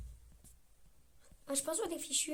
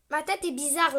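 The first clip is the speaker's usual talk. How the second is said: usual speaking voice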